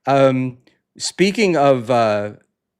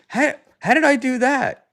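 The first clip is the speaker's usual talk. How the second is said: high-pitched retold protest; mock bewilderment; 12 semitones higher than usual. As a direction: play up baffled innocence, high voice. Retold protest to accusing mom.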